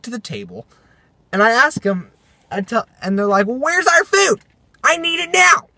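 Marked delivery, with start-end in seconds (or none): 3.5-5.7 high-pitched imitation of demanding teens; hammed-up teen outburst; mock yelling. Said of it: "they're yelling at me".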